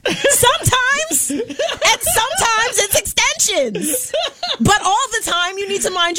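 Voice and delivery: high, slow, drawn-out comic reply; mock-patient retort. Woman answering nosy hair question, mock patience. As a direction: stretch each word in high-pitched mock patience.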